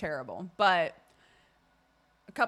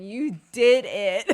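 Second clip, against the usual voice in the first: laughing while saying it; slow, laughing, louder; 9 dB louder, slower than usual. Real, not acted. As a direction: giggle through the line. Laughing retelling.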